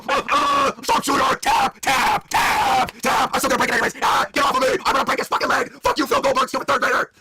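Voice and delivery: high, strained mock fury; hammed-up wrestling shouts. Principal putting administrator in sharpshooter, strained high shouting. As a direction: yell through the effort of a hold.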